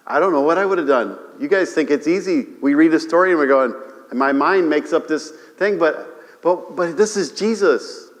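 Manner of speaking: ordinary delivery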